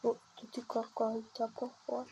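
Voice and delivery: ordinary talk, nothing marked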